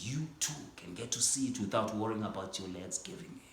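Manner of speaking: usual speaking voice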